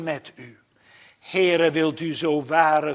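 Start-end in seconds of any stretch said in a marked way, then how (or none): none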